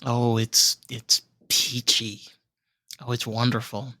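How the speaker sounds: slow, dry sarcasm; deadpan irony, said slowly; sarcastic. Acted. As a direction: drag it out as if it's anything but peachy. Sarcastic about South Florida traffic.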